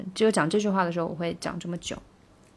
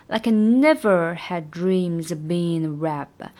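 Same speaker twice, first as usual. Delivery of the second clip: never stressed, like I linked, a bit slower; slower than usual. Shown as a demonstration. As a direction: hit never, link like I. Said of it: "这个地方never要重读". (never重读，like I连读)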